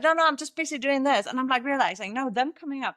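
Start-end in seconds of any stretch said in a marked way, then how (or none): 0.0-1.2 higher, louder hurried dismissal; mock flustered mom; busy brush-off. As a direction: wave the kids off while busy. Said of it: "And I'd be like".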